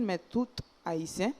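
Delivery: ordinary talk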